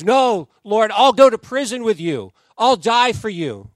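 loud, earnest pledge of loyalty; Peter's loud vow. Retold or imitated. Preacher voicing Peter's vow to Jesus at the Last Supper, loud and earnest. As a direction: declare it loudly, all in.

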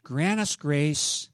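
earnest prayer voice, slowing on the pleas; closing prayer. Acted. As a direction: pray over the congregation, drawn out. Pastor leads closing prayer.

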